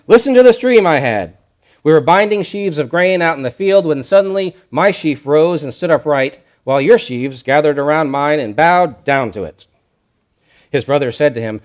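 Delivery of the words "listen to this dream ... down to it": put-on raised, louder voice of a young boaster; eager boastful Joseph. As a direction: tell the dream like a proud kid bragging. Joseph boasting to his brothers.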